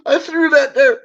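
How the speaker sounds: high repeat, stress on "that", laughing through it. Retold or imitated. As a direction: laugh while repeating the excuse, leaning on "that".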